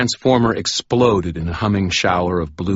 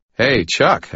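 friendly call-out, slightly raised; Harry voice; 7 semitones higher, 6 dB louder than usual. Acted. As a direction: hail a drunk worker casually. Harry calls out to electrician.

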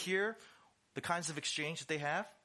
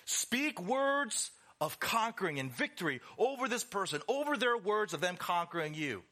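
higher, forceful pep talk; fired-up coach; 6 semitones higher, 3 dB louder than usual. Acted. Coach tells fighters to speak over opponent, fired-up urging. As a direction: drive it home like a fight coach.

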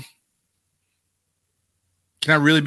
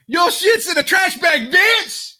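mock yelling boss; slower than usual. Boss calling up a fired wrestler, mock yelling at her. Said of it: "Is that what Mark Carrano was doing?".

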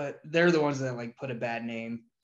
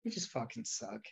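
softer flat repeat; muttered; 2 semitones lower, 8 dB quieter than usual. Real, not acted. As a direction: drop it quietly as an afterthought. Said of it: "That's all".

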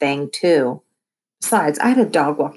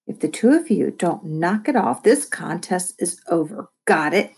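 ordinary delivery

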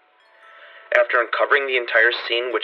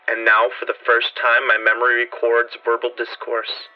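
plain reading voice

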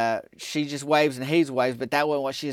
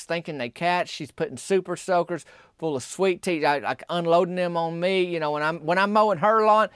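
normal talking voice

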